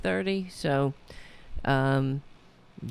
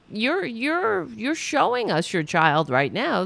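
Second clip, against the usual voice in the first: normal talking voice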